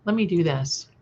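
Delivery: usual speaking voice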